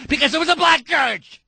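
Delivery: high, outraged rising voice; indignant. Real, not acted. Host mocking church objectors, indignant. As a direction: build to an incredulous high cry. Host's rant builds to outrage.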